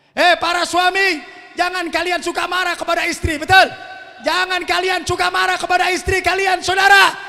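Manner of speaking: preacher calling out the husbands; preaching at full force. Acted. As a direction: thunder it at the husbands. Exhorts the husbands loudly.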